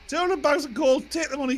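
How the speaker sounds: high, loud bird squawk; squawking parrot. Acted. Parrot telling the husband about the coal, high squawk. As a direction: parrot it straight back. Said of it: "Parrot goes".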